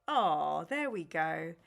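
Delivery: ordinary talk, nothing marked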